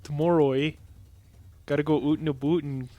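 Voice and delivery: hammed-up Canadian accent with rounded vowels; mock Canadian accent. Teasing a friend about Canadian English, mock Canadian accent. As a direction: put on a cartoon Canadian lilt. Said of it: "the difference between Canadian English and American English".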